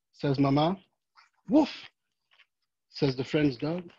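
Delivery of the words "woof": short high playful woof; cheerful puppy woof; dog bark. Acted. Friend's dog barks.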